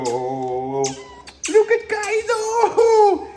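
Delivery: very high, drawn-out cooing. Real, not acted. Keeper cooing at his pet otter, high baby talk.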